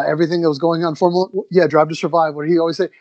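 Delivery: usual speaking voice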